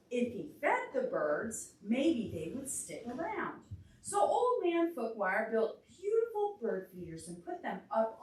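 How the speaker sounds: lively storybook reading; reading to a child. A narrator's reading. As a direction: read it aloud for a little listener. Reads picture book to child.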